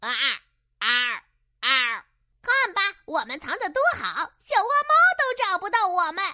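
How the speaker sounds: high, fast smug little crow. Acted. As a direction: boast quickly in a high voice. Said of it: "得意地跟小兔子说道". (得意洋洋的小乌鸦)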